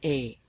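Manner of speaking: E as in English "way", pitched low; a low, closed "ay" for E; E with acute accent. Shown as a demonstration. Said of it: "as in the English word way".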